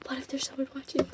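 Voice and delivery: hushed, quick scared voice; teasing a friend's paranoia; mock fearful. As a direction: whisper it like you're scared. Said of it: "She's in the kitchen like".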